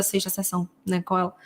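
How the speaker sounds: ordinary talk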